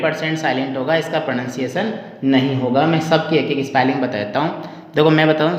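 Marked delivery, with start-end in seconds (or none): none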